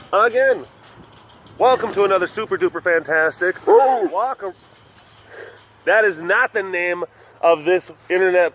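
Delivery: goofy host voice introducing the show; hammed-up show host. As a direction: overplay the cheery webcast host. Hams up a host voice.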